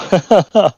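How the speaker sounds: short, high burst of laughter; amused laugh. Real, not acted. Driver hoping for another safety car lap, laughing. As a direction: laugh at your own wishful thinking. Amused at own wishful thinking.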